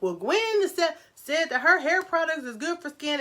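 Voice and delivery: high-pitched mock complaint; imitated complaining viewers. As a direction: mimic viewers blaming her, pitched well up. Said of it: "before y'all be out here like".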